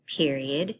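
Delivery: usual speaking voice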